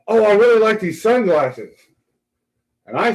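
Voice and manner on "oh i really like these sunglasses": high, mock-casual offer; imitating the drunk official, pitched up and breezy; drunk passenger. Imitating the drunk judge offering a favor, high voice. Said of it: "And he goes".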